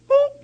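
high, sudden pop; a quick high "boop!" as something flips; popping "boop". Shown as a demonstration. Mimics suddenly twisting off.

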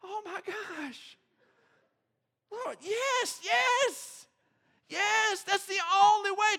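high, slow joyful cry of yes; a man swept up in relief and joy; overjoyed. Retold or imitated. Pastor reliving his joy at the hug, high and elated. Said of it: "and I'm just like".

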